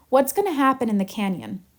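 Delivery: ordinary talk, nothing marked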